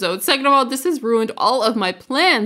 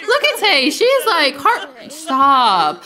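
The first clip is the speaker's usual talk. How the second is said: usual speaking voice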